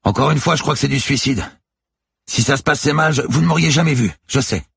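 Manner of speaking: high, loud, urgent voice; shouted exchange. A narrator's reading. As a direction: argue over the noise of waves. Heated exchange on the boat.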